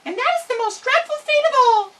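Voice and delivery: high, small squeaky-voiced mouse; field mouse voice. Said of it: "added the field mouse".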